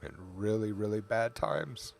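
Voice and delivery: real, held-back emotion with long pauses; heartfelt tribute, halting and choked up; getting emotional. Real, not acted. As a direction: speak softly, pausing to hold yourself together. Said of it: "sorry, I'm gonna get emotional".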